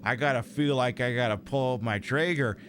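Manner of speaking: lightly put-on Canadian accent with eh. Gamer voicing his character, light Canadian accent. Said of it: "I should be doing this in a different accent".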